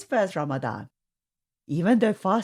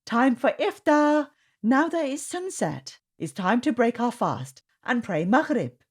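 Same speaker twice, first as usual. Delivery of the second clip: cheerful announcement; 7 semitones higher than usual. A narrator's reading. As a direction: call the family to dinner gladly. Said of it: "Mum announced".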